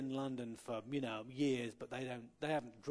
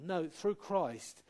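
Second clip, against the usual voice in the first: ordinary talk, nothing marked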